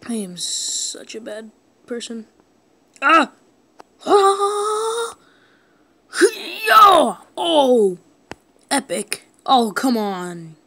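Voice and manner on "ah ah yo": loud high cries; excited yelps. Real, not acted. Gamer yelping at a big hit. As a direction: yelp in surprise.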